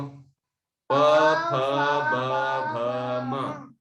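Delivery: प, फ, ब, भ, म said one by one with the lips; lip consonants drilled. Shown as a demonstration. Teacher demonstrating प फ ब भ म to the class. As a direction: sound out प फ ब भ म, each on its own. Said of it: "फिर से बोलो".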